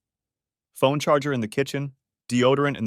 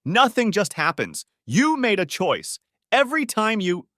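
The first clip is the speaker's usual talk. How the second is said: angry berating; 11 semitones higher, 4 dB louder than usual. Acted. Husband berating his cheating wife, angry. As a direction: hammer each accusation home.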